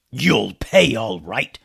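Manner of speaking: slow, loud pirate threat; menacing pirate captain. A narrator's reading. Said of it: "said the captain".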